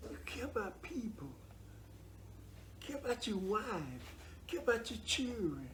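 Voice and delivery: quiet pleading counsel; soft plea. Real, not acted. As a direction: say it gently like heartfelt advice. Pleading advice, voice dropping.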